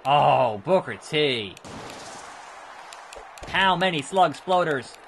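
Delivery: ordinary talk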